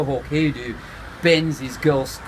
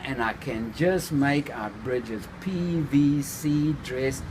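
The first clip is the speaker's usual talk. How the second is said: ordinary delivery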